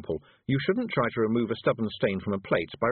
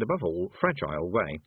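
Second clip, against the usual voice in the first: plain reading voice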